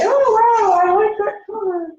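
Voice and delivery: high, loud, thrilled "oh wow"; delighted exclamation. Pretend. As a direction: gasp with delight at the color. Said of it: "the creator inside can just say".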